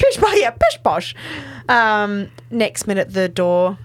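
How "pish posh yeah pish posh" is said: high-pitched mock posh accent. Host imitating a character's suspicious posh talk, mock posh accent. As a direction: put on a hammed-up posh voice, pitched well up. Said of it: "posh accent".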